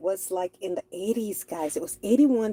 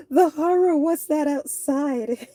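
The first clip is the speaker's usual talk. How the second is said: hammed-up horror, higher and louder; mock horror; 6 semitones higher, 4 dB louder than usual. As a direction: play it like a campy horror scream queen. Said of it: "everyone stays inside and go".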